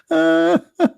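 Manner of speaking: high-pitched leftover giggle; short burst of high laughter; helpless laughter. Real, not acted. Cannot stop laughing.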